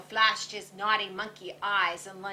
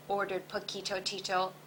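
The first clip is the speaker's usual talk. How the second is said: plain reading voice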